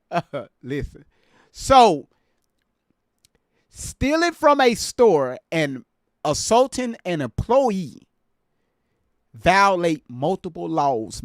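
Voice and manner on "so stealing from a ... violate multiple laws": slow, loud, emphatic lecture voice, pitched up; slow, raised schoolteacher delivery; lecturing. Real, not acted. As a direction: spell out the law to the viewers like a stern teacher. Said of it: "Let me be serious and educate the people".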